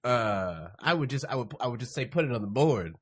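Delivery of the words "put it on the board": usual speaking voice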